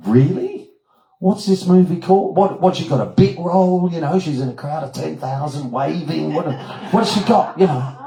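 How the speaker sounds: high, animated burst of questions; excited questioning. Retold or imitated. Teller quizzing Doug about Margot's movie, excited. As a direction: fire off the questions, pitched well up.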